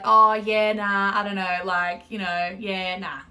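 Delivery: loud, breezy noncommittal yeah nah; casual Aussie dodge. Retold or imitated. Answering a mate's question with a loud breezy shrug. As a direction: shrug off the question, mock laid-back. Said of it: "And then we would be like".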